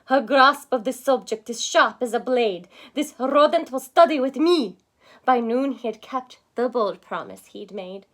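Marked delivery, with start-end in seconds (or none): 0.1-4.8 loud, emphatic praise; proud professor. Acted. Professor telling the class about the mouse, loud proud praise. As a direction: announce it proudly.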